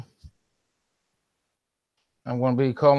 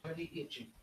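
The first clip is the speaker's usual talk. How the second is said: whispering; 22 dB quieter than usual. Real, not acted. Muttering to himself off mic.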